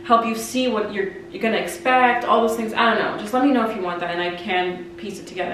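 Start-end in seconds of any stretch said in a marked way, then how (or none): none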